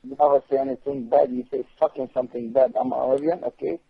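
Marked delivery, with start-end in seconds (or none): none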